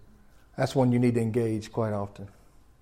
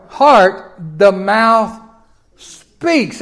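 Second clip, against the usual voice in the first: loud, high preacher cadence, slowing on the punch lines; full-force Bible reading from the pulpit; preaching scripture; 7 semitones higher, 9 dB louder, slower than usual. Acted. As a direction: proclaim the verse from the pulpit with fire.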